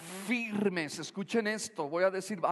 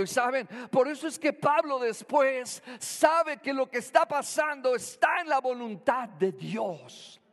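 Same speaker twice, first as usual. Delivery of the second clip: fervent, high-pitched sermon delivery; preaching; 3 semitones higher than usual. Acted. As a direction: preach it with fire to the congregation. Sermon at full force. (predicación enérgica)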